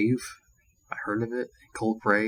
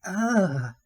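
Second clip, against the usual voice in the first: high, drawn-out imitated moan; mock sexual moan; 9 semitones higher, 3 dB louder than usual. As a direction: mimic her suggestive "ah". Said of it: "she was moaning again".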